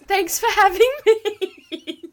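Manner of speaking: high, loud, slow theatrical thanks; mock grand. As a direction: ham up the thank-you.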